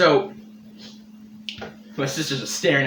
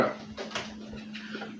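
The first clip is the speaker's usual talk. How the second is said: normal talking voice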